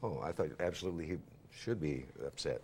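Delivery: ordinary talk, nothing marked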